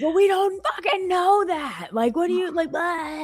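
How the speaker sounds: angry yell. Retold or imitated. Sister-in-law yelling back at the dog owner. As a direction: snap back at the owner in outrage. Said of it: "we started yelling back and forth".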